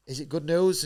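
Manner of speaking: high, loud eager ask; eager question. Retold or imitated. Boxer asking the consulate for news, eager and high. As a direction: blurt it out hoping for yes. Anxious hope on the phone.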